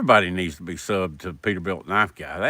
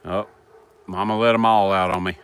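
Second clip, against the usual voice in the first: ordinary talk, nothing marked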